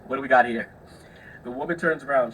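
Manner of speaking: ordinary talk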